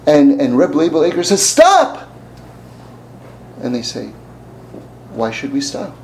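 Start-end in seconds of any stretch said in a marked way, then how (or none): 1.5-2.1 high, loud cry; shouted stop. Retold or imitated. Rabbi cries stop. 5.1-6.0 calm low reply. Retold or imitated. Men answer calmly.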